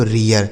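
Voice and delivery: रियर said "ree-yar"; ear vowel glide. Shown as a demonstration. Teacher contrasts it with read, रियर as "ree-yar". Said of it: "वह ई ए हो जाएगा".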